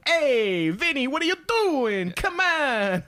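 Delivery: loud, high, drawn-out New York Italian; New York Italian accent. Acted. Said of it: "like, the New York Italian accent".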